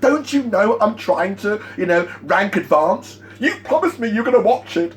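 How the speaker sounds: mock a rep losing it and guilt-tripping; hammed-up needy nagging, pitched high; desperate whiny complaint. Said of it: "completely lose it without any posture".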